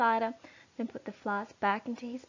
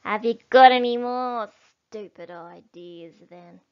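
sour little dwarf asking; a needling jab at Bean; grumpy dwarf; 2 semitones lower, 9 dB louder than usual. Acted. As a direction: Bunce grumbling at Bean. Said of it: "looked up at Bean and said".

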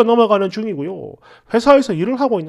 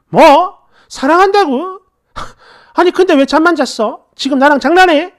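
put-on high, indignant girlfriend; angry yelling; 6 semitones higher, 4 dB louder than usual. A narrator's reading. Girlfriend challenges boyfriend's claim of love, angry yelling. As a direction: throw his words back at him, raised and angry. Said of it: "버럭버럭 소리를 지르고".

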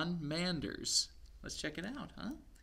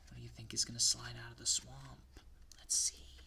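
hushed suspense question; whisper; 4 dB quieter, faster than usual. Real, not acted. Asks kids in suspense.

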